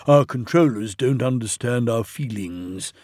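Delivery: ordinary delivery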